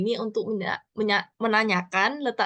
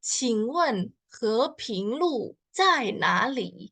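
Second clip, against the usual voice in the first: slow demo; 2 semitones higher, much slower than usual. Shown as a demonstration. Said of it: "gimana cara bacanya?".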